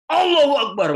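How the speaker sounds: loud, high cry of praise re-enacted; shouted takbir. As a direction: yell it out as if riding a motorbike. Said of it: "sambil teriak". (teriakan takbir yang lantang)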